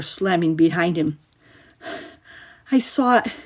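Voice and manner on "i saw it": out of breath from running; boy panting in fear. A narrator's reading. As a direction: gasp the words out. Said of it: "he panted".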